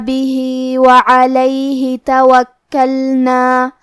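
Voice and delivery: slow, measured tajweed chant; Quran recitation. Acted. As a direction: recite slowly, drawing out every vowel. Recites Quran verses slowly. (آہستہ تجوید کے ساتھ قرآن کی تلاوت)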